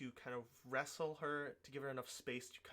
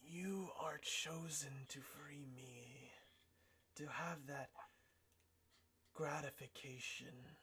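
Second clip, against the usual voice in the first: raspy whisper voice; 3 dB quieter, slower than usual. Acted. Same entity speaks.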